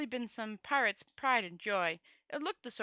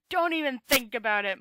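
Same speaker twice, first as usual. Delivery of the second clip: moaning protest; 2 semitones higher, 9 dB louder than usual. A narrator's reading. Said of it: "moaned Rincewind".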